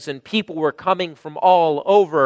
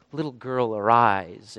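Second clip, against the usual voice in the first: soft, low and gentle; tender; 7 semitones lower, 4 dB quieter than usual. Acted. Jesus waking the dead girl, soft and tender. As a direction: wake her as gently as a father would. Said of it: "it's so tender".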